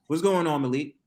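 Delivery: ordinary talk, nothing marked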